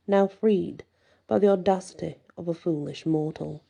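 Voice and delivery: ordinary delivery, nothing marked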